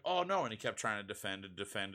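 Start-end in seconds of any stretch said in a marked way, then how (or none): 0.0-0.4 high, louder mock dismay; a deflated kid caught out; a kid who got scolded, in mock dismay. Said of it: "the kid was just like".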